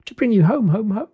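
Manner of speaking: put-on high, bubbling child voice; gleeful little girl. Little girl tells her brother he's going home, gleeful. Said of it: "bending down to laugh".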